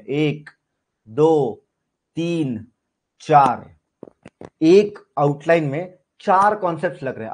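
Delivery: ordinary delivery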